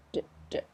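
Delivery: D, d popped out very softly; isolated D sound. Shown as a demonstration. Teacher showing the final d to learners, soft pop. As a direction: pop the d gently. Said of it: "Pop it right on the end".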